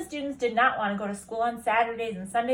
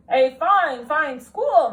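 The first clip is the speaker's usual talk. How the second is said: loud proud principal; 4 semitones higher, 10 dB louder, much slower than usual. Acted. Principal character voice.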